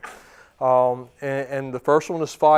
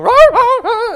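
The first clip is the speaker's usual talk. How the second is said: much higher and louder roar through a laugh; laughing mock roar; 13 dB louder, slower than usual. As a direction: ham up a bluffing dog's growl. Dog posturing then fleeing.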